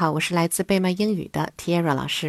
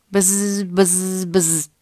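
drawn-out storybook bee buzz; slower than usual. A narrator's reading. Bee flying by the bunny, buzzing. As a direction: imitate a bee flying past. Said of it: "a bee flew by, saying".

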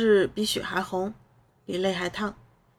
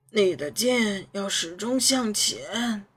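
slow, lightly voiced old swordsman; slow grave mentor voice; old man voice; much slower than usual. A narrator's reading. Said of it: "李长青说".

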